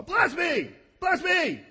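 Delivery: high, loud mock begging; mocking shouted whine. Preacher mocking Esau's begging to his father, high shouted whine. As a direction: hammer the whiny pleas. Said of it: "To recap the words of Esau".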